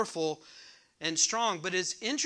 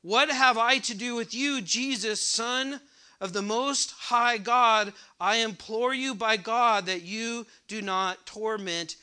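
raised, pleading voice of the possessed man; higher, louder, emphatic imploring; retold loud cry; 4 semitones higher, 6 dB louder than usual. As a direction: read it out loudly, as if crying out. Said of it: "he cried out with a loud voice".

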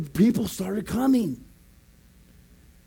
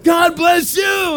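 high, loud, slow holler; imitated street greeting; 9 semitones higher, 7 dB louder, much slower than usual. Locals and pastor trading blessings, drawn-out shout. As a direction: shout it out long across the street.

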